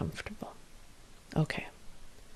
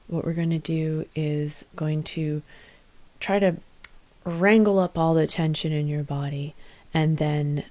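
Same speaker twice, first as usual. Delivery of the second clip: ordinary delivery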